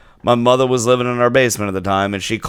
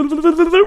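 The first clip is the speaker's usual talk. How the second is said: cartoon noise; much faster than usual. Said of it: "like, Looney Tunes".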